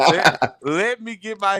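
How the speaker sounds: slow, laughing through the words; chuckling as he speaks. Real, not acted. Laughing at his own joke.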